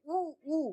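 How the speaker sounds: ऊ drawn out, soft and long; ऊ held long; long oo sound. Shown as a demonstration. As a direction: stretch the ऊ gently. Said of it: "ऊ के sound के साथ पढ़ेंगे".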